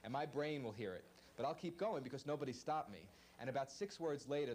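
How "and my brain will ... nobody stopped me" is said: ordinary delivery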